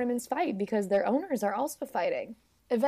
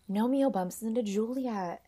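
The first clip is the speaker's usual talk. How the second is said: ordinary delivery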